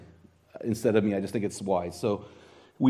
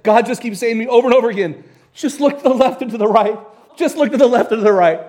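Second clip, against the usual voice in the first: higher and louder, swept up in the moment; excited retelling; 9 semitones higher, 8 dB louder than usual. Retold or imitated. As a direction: retell her words with mounting excitement. Retelling woman's moving revelation.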